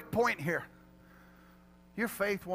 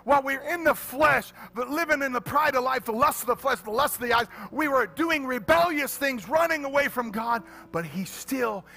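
higher, louder preaching with music; full-force preaching; 6 semitones higher, 8 dB louder than usual. Acted. Preacher proclaiming salvation to the congregation at full force.

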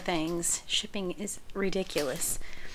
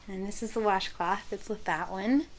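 ordinary delivery

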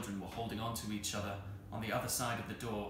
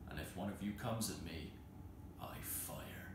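softer, lower threat; menacing low; 5 semitones lower, 9 dB quieter than usual. Acted. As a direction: drop to a quiet threat. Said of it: "deep-chested words through the keyhole".

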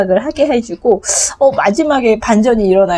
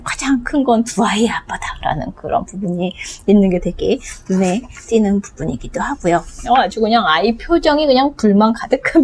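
ordinary talk, nothing marked

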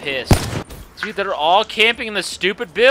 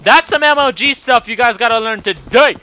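excited bragging; 2 semitones higher, 6 dB louder than usual. Real, not acted. Player boasting to viewers after a turnaround, excited.

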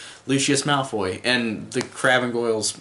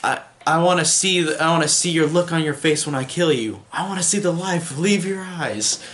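mock scream, pitched well up; Voldemort; 6 semitones higher than usual. Villain screaming at the fleeing boy he means to kill, high-pitched mock yell. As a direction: imitate a villain's scream without full volume. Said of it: "Voldemort just screams".

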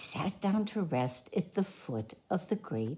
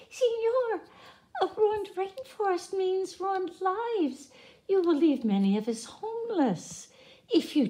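much higher, piping frog voice; squeaky tree frog; 13 semitones higher than usual. A narrator's reading. Tiny frog pipes in the man's ear, squeaky high. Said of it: "In a squeaky voice, he piped".

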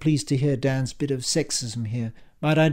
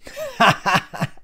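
high, acted mocking laugh; scornful laugh; 3 dB louder than usual. A narrator's reading. Ian laughs at Dan's peace treaty offer, scornful. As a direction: burst into derisive laughter. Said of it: "Ian started laughing at this".

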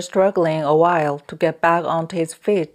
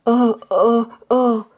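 higher-pitched, startled "oh, oh, oh"; mute's wordless cries; 6 semitones higher, 4 dB louder, slower than usual. A narrator's reading. The wide-eyed mute struggles over the towel, crying out wordlessly. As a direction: voice a mute's repeated inarticulate cry. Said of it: "The mute, wide-eyed, uttered".

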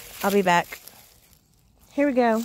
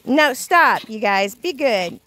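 usual speaking voice

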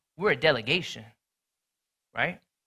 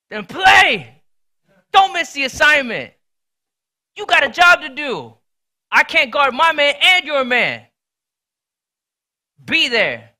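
loud, higher and hard, giving orders; scolding shout; 8 semitones higher, 12 dB louder than usual. Retold or imitated. Brother scolding his teammate on the court, loud angry yell. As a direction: bark orders at a teammate who slacked.

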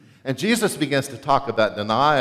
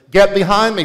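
sharp rebuke; 6 semitones higher, 11 dB louder than usual. Retold or imitated. Jesus rebukes Peter.

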